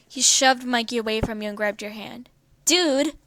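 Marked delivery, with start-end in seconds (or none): 2.6-3.2 high, loud indignant dude; loud protest. Acted. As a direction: cry out at being shoved. Mikey shoved away, protests.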